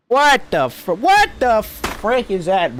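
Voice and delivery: high, slow stunned outburst; shocked. Real, not acted. Gamer stunned by what appears on screen. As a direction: drag out the disbelief.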